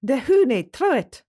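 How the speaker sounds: loud, slow, high Scottish call to a dog; a thick Scottish shepherd's command; thick Scottish accent. A narrator's reading. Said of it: "called in a thick Scottish accent".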